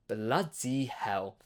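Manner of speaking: slow, soft, drawn-out bloody hell. Retold or imitated. To the Cyclops eating six men, soft slow disbelief. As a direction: let it sink in, quietly appalled.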